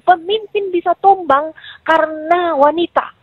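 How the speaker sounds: high, slow, emphatic retort; retold angry warning. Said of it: "Ngamuk, saya nggak tahu kenapa masuk jin". (menirukan peringatan marah, lambat dan tinggi)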